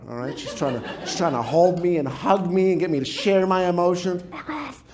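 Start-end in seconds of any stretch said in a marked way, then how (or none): 4.3-4.8 put-on angry child. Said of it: "Angry child".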